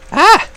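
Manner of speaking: short, high, loud yelp; loud startled "ah"; startled scream. Real, not acted. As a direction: let out a sudden scream. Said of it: "Why am I screaming?".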